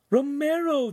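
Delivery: very high-pitched cry of alarm; frightened captive calling out. A narrator's reading. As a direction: cry his name out high in distress. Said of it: "Giulietta cried".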